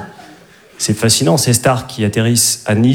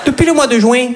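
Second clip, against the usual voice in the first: put-on much higher, sing-song Midi accent; southern French accent; 10 semitones higher than usual. New York romcom couple flirting in a southern French accent. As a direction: play a romcom lead with a Marseille lilt. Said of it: "avec l'accent du Midi".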